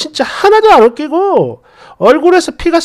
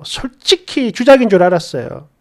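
plain reading voice

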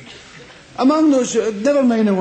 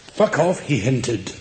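low, slow deadpan "Fuck off, he hinted."; a mock novel line read dry; 10 semitones lower, slower than usual. Shown as a demonstration. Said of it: "you never read".

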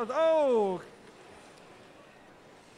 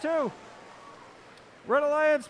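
normal talking voice

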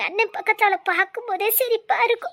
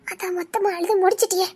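ordinary delivery

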